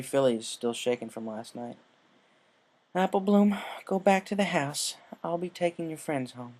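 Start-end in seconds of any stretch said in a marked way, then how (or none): 2.9-6.5 put-on firm older sister, higher voice, sending the little one off; lightly stern command to the little sister; stern big sister. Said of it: "she said sternly".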